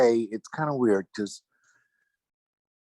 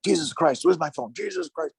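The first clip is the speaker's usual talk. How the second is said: high, frantic mock fretting over a lost phone; a hammed-up panic over a missing phone; exasperated outburst; 9 semitones higher than usual. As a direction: imitate a flustered old rocker, pitched way up. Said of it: "he'd go".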